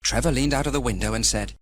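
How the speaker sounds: usual speaking voice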